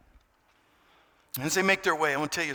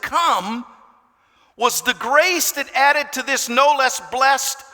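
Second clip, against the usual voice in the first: slow, swelling reading; building preacher's reading; reading aloud; 5 semitones higher, 6 dB louder than usual. Acted. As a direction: read it as a rising proclamation. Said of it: "Now here it is".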